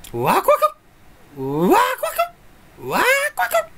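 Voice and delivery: squealed, stretched wakuwaku; excited wakuwaku. Shown as a demonstration. Showing how excited he is, squealing wakuwaku. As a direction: act out bubbling excitement. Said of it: "すごいワクワクしちゃってる".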